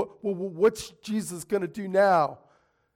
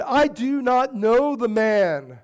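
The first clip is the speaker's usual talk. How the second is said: louder, higher, slow angry denial; 5 semitones higher, 5 dB louder, slower than usual. Retold or imitated. Peter to the man by the fire, loud slow angry denial. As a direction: spit out each word like a cornered man. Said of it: "cursing like a sailor".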